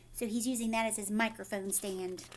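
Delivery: ordinary delivery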